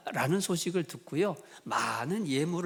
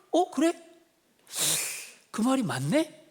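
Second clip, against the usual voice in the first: much higher startled "어, 그래?" then dawning agreement; sudden realization; 7 semitones higher than usual. Retold or imitated. General hearing his servant's advice, surprised and conceding. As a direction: caught short, then won over.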